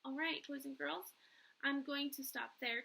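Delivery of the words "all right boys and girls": ordinary delivery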